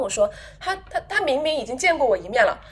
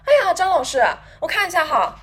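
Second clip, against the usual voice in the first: mock-loud needling colleague, higher, louder by the end; loud teasing; 6 semitones higher, 4 dB louder than usual. Imitating a colleague loudly pointing out she is late, rising into a laughing shout. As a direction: announce her lateness for the whole office to hear. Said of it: "他就特别大声地说".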